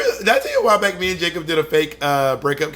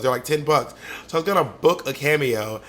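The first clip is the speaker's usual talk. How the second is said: ordinary talk, nothing marked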